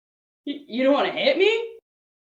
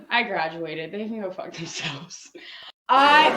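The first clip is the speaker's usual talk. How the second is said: ordinary talk, nothing marked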